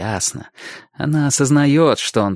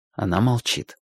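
ordinary delivery, nothing marked

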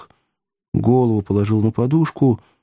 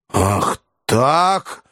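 hunted cry; 3 semitones higher than usual. A narrator's reading. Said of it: "дико и затравленно озираясь".

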